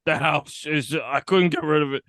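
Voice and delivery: lightly voiced punch-drunk man; a hint of a dazed mumble; dazed wounded man. Mocking the armless character's confession, lightly dazed. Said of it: "and he's just like".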